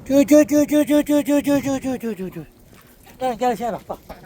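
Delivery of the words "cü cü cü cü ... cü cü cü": chicken call. Real, not acted. Woman calling chickens to the bucket, high repeated call.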